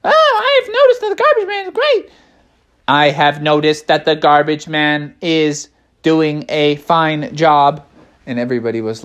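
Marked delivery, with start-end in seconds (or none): 0.0-2.1 very high, squeaky character voice; high-voiced bear. A bear chimes in about the garbage man, very high voice. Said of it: "they're all like". 2.9-7.8 level, deliberate delivery in a natural register; measured bear. Acted. Another bear gives an opinion on the garbage man, measured voice. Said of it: "they're all like".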